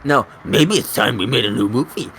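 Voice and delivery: deep dramatic trailer voice; horror trailer voice. Shown as a demonstration. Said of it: "No, wait".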